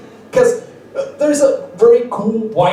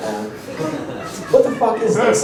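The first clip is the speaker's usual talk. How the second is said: ordinary delivery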